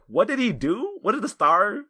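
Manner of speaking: high-pitched, puzzled string of questions; incredulous, rising into a laugh; baffled questioning. Real, not acted. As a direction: demand answers in amused bewilderment. Exasperated questions about unexplained plot.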